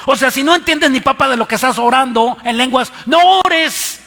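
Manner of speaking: high, loud and forceful rebuke; fiery sermon shout, no ores; preaching shout. Real, not acted. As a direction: thunder the command don't pray.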